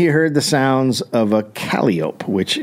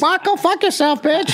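high squawking parrot, much higher. Pretend. Foul-mouthed parrot squawking insults at a guest, high parrot voice.

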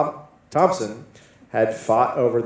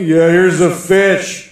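slow silly voice; 5 semitones higher, 9 dB louder, much slower than usual. Acted. Paying with a fish bit.

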